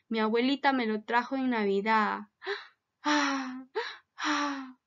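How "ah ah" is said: a couple of quiet sad gasps; soft sad gasps. Acted. Girl finds her toy gone, soft sad gasps. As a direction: gasp softly like the sad little girl.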